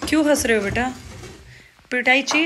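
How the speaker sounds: mother loudly threatening a spanking; scolding threat. Real, not acted. Mother threatening giggling child.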